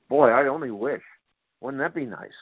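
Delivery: normal talking voice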